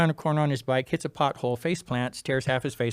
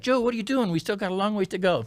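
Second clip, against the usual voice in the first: high-pitched retold protest; alarmed crew; 8 semitones higher than usual. Crew catches up with the sprinting racer, alarmed. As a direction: call out in alarm, pitch jumped up.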